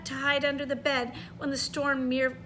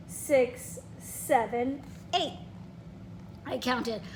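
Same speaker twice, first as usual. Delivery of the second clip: ordinary delivery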